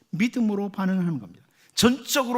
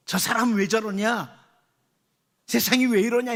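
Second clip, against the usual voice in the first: grumbling complaint; 3 semitones higher, 3 dB louder than usual. Retold or imitated. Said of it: "이게 아니고".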